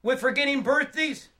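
high, emboldened call across the room; calling back. Acted. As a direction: shout a playful question to the back. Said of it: "I smile and call back".

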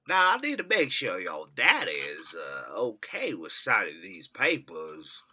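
lightly put-on Colonel. Impersonating Hanks as the Colonel.

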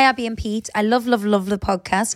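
ordinary talk, nothing marked

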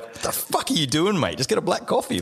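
high, exasperated disbelief; incredulous outburst. Retold or imitated. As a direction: squeal it like you can't believe your ears.

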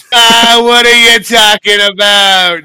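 loud, high New York shout with a laugh. Acted. Game master echoing the player in a shouted New York accent. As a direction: yell it like a brash New Yorker.

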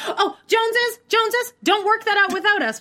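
high, pleading mock producer voice; high mocking plea; mock doctors pleading. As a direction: squeak it like a fussy producer. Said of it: "they're like".

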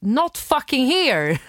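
slow, loud, drawn-out curse; angry retort. Retold or imitated. Woman telling intruder off, slow loud angry English. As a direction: drag it out in disbelief.

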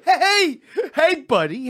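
loud high call of a bar buddy; Gino calling out. Retold or imitated. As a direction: shout it high and cheery.

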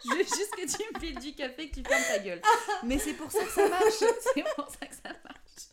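talking through high laughter; laughing. Real, not acted. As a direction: crack up while talking.

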